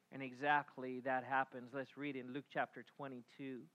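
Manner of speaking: usual speaking voice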